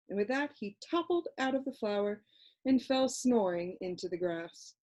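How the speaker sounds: ordinary delivery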